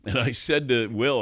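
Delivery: ordinary talk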